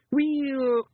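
high, drawn-out evasive well; shifty. A narrator's reading. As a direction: stall guiltily on one word. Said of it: "Rob looked a little shifty".

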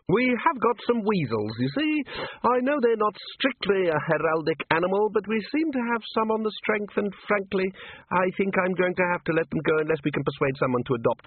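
put-on very high, aghast little old man; scandalized at the very idea; high fluttery herald voice; fussy old herald.

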